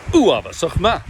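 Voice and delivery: Uavasach ma with a short stressed first syllable and a fricative ch; correct pronunciation. Shown as a demonstration. Coach's final model pronunciation for students. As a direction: say Uavasach ma with initial stress and a throaty ch. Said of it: "you'll pronounce it perfectly".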